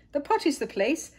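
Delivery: the princess boasting, pitched high; proud. A narrator's reading. Little princess showing off her potty, proudly high. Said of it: "said the little princess proudly".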